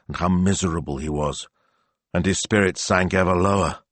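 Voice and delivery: usual speaking voice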